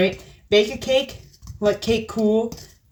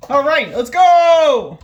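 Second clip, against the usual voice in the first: loud, slow hype-up; excited; 4 semitones higher, 6 dB louder, slower than usual. Real, not acted. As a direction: psych yourself up loudly. Starting the mixer.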